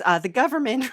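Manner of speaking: ordinary delivery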